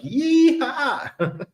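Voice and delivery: high, drawn-out yeehaw breaking into laughter; gleeful whoop. Retold or imitated. Coming back to see crypto gains, playful high whoop. Acted cheer at finding big gains.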